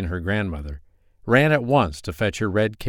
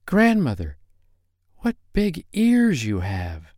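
put-on wondering little girl; 7 semitones higher, slower than usual. A narrator's reading.

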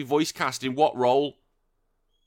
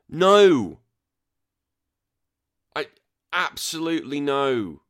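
loud high burst of no; exasperated no; 4 semitones higher, 5 dB louder, slower than usual. Real, not acted. Vlogger refusing the actor's complaint, loud exasperated no. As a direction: blurt it out, fed up. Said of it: "exasperated refusal".

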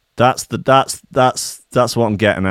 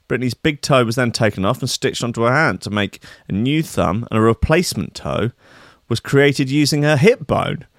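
ordinary talk, nothing marked

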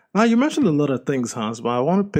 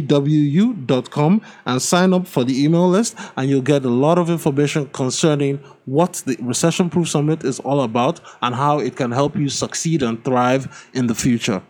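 upbeat, slightly louder ad-read voice; sponsor ad read; 5 dB louder than usual. Acted. Host reading a sponsor message to listeners, upbeat ad read. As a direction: sell the summit like a radio spot.

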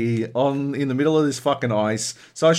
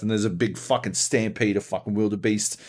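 ordinary delivery

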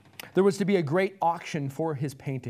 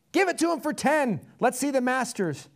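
very high, raised shout; impatient heckle; 8 semitones higher, 4 dB louder than usual. Acted. Crowd member wanting the masters, shouting high. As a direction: yell it impatiently across the room.

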